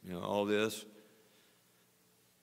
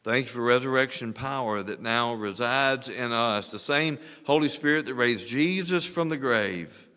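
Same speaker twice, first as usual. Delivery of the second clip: earnest prayer rising louder toward the end; closing prayer; 6 dB louder than usual. Acted. Pastor leading the standing church in prayer, fervent prayer voice. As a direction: pray over them, building in fervour.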